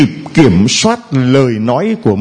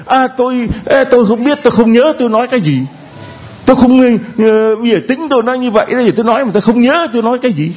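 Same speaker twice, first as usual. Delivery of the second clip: high, hurried mock excuse; nhại người chối lời; 8 semitones higher, much faster than usual. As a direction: imitate someone stammering out denials. (nhại giọng ấp úng chối quanh, cao và nhanh)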